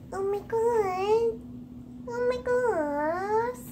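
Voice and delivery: slow, drawn-out awe; slow awed gasp. Real, not acted. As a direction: marvel at the lizard slowly. Slow awed cooing at lizard.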